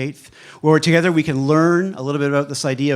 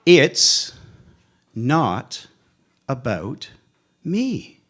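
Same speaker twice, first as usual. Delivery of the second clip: IT'S. NOT. ABOUT. ME. with long pauses; word-by-word reveal; much slower than usual. Real, not acted. Speaker unveils the four words to the audience, word by word. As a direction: drop each word alone, lifting on "me".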